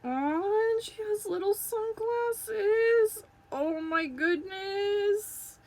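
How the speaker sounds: high, slow, drawn-out squeal of affection; adoring coo. Real, not acted. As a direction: coo over something adorably tiny. Cooing over the longed-for toy.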